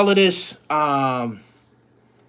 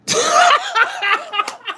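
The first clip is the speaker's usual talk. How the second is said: high laugh. Real, not acted. Laughing at baby mom's question.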